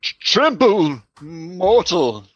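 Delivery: slow, commanding vampire voice; master vampire voice. Pretend. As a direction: deliver it like an ancient vampire lord. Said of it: "a master vampire voice?".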